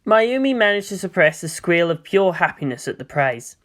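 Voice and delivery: plain reading voice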